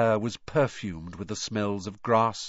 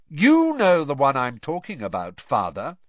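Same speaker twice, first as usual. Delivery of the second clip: pointedly louder, leaning on "you"; a self-important son hinting loudly; raised voice; 5 semitones higher, 8 dB louder than usual. A narrator's reading. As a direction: raise your voice to make a point. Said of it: "He raised his voice slightly".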